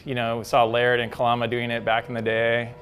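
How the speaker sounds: ordinary delivery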